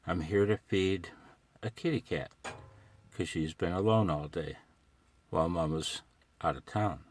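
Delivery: usual speaking voice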